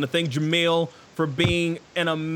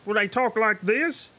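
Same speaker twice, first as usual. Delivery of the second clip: trying out a higher puppet voice; trial puppet voice; 5 semitones higher than usual. Shown as a demonstration. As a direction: test a character voice as a question. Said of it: "and I, I say".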